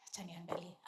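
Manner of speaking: hushed whisper to herself; whispered aside. Retold or imitated. Whispering to herself before peeking out of the closet. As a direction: whisper it as if sneaking a look. Quietly deciding to go look.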